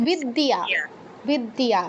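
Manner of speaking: বিদ্যা as vid-diya, y sounded; careful বিদ্যা with a clear iya ending; full y sound. Shown as a demonstration. Teacher giving an example, বিদ্যা as viddiya. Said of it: "এরকম".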